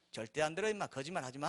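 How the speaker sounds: very soft, offhand refusal; quiet dismissal. Acted. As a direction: wave off the devil under your breath. Brushing off the devil.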